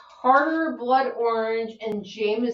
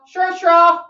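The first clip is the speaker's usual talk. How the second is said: "Sure, straw" higher and louder, called out; mock announcing call; 8 semitones higher, 9 dB louder than usual. Reading the drawn drink name aloud to friends, mock announcing.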